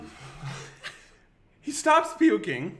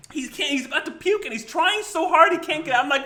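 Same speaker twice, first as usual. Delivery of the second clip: usual speaking voice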